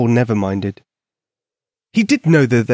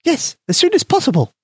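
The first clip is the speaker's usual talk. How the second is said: high, tiny mouse voice; squeaky mouse voice. A narrator's reading. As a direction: voice a small eager mouse. Mouse Polka speaks.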